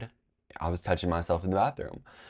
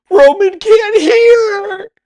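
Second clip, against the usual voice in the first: very high, drawn-out mock wail; freaking-out scream; 16 dB louder, much slower than usual. Girl telling the teacher about Roman, freaking-out scream. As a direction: scream it slowly in hammed-up panic. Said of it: "started freaking out".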